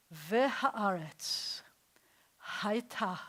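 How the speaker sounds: Vehaaretz, hayta slow and breathy, with the h breathed out; breathy Hebrew. Shown as a demonstration. Teacher showing students the breathy Hebrew. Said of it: "listen to what it is in Hebrew".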